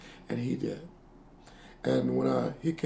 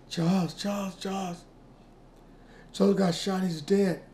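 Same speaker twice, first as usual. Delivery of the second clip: higher, frantic calling of his name; retold mother's scream; 7 semitones higher than usual. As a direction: cry out the news like a panicked mother. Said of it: "I heard my mother scream".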